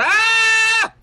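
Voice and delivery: sudden high loud scream; short loud cry of alarm; startled scream. Real, not acted. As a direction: yelp as the spider bites. Attacked by spiders.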